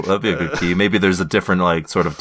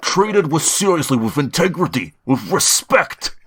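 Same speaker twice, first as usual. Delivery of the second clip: put-on Bernie Sanders accent; 8 semitones higher than usual. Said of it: "you almost veered into Bernie Sanders".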